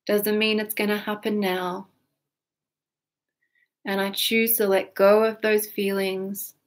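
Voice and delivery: gentle guided tapping script; tapping affirmations. Acted. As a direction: speak each affirmation calmly with pauses. EFT tapping affirmations, slow.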